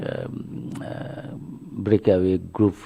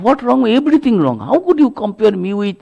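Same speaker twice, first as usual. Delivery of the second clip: higher, louder indignant retort; angry scolding; 13 semitones higher, 6 dB louder than usual. Retold or imitated. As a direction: fire it back at the Master like an outraged young man. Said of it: "started scolding Sri Ramakrishna".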